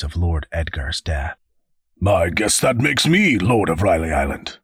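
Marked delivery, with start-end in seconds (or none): 2.1-4.6 careless, offhand character voice; off-handed carelessness. A narrator's reading. Raymond on hearing of Lord Edgar's death, off-handed. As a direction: toss it off without a care. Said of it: "with an off-handed carelessness".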